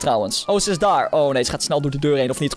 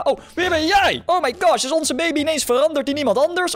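surprised; 10 semitones higher than usual. Real, not acted. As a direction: sound genuinely startled, voice jumping up. Surprise at changed baby.

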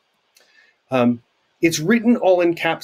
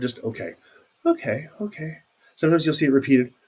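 ordinary delivery

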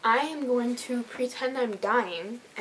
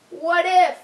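indignant outburst; 5 semitones higher, 9 dB louder than usual. Acted. Scolding dog for not helping.

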